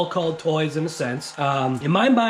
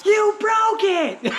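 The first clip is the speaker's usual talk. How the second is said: high, drawn-out mock scream of a little boy; imitated kid's scream; much slower than usual. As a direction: screech it like the neighbor kid, stretching the words. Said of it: "he screams, like, at the top of his lungs".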